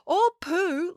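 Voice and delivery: high, sing-out interjection; helpful call-out. A narrator's reading. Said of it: "called out helpfully".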